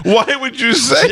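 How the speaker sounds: very high, drawn-out tearful wail; hammed-up crying squeal; mock weeping. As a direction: imitate her sobbing in reproach. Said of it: "She starts literally weeping".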